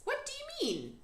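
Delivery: put-on high, light girlish voice; little girl voice. A narrator's reading. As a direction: play young Sophie, curious. Sophie's lines, little girl voice.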